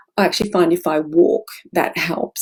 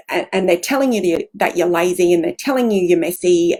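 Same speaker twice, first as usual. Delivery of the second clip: ordinary delivery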